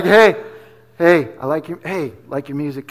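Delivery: low, understated fan compliment; playing it cool. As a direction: drop it low and casual, acting unimpressed. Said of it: "I knew how to be cool".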